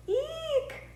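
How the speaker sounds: sudden very high squeal. A narrator's reading. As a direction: shriek like kids speeding downhill. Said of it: "cried all the kids".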